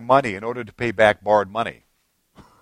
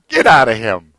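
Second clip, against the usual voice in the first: mock divine order, low and loud; lightly voiced Lord, louder command; 4 semitones lower, 8 dB louder than usual. The Lord by the bed casting out a demon, loud command. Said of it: "the Lord's standing by your bed".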